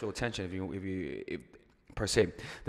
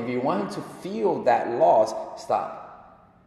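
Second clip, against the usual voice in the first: normal talking voice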